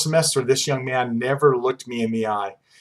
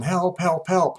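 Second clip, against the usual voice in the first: high-pitched mock cry for help; retold yelling, pitched up; imitated yell; 6 semitones higher, slower than usual. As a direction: call out for help without real volume. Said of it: "with somebody yelling".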